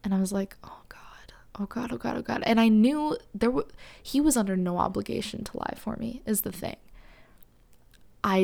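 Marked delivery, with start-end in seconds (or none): 0.6-2.4 a scared little whispered prayer; panicked whisper. Retold or imitated. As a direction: whisper it fast under your breath, oh God on repeat. Panicked inner voice after refusal.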